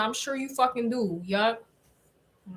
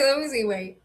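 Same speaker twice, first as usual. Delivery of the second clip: ordinary delivery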